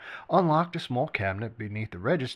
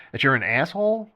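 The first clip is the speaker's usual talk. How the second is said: retort; 4 semitones higher, 7 dB louder than usual. A narrator's reading. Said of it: "Benji retorted".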